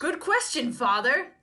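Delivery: mock penitent. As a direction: answer the priest with mock gravity. Role-playing confessing woman.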